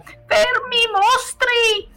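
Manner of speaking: very high, put-on shriek; yelling baroness. A narrator's reading. Baroness yells at piglets chewing her furniture, high shriek. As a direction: shriek the order in a high lady's voice. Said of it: "urlava la baronessa".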